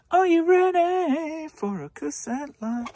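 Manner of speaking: slow, big game-show announcement; announcer catchphrase. Pretend. Reseller hyping his next lot, booming game-show call.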